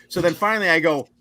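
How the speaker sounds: usual speaking voice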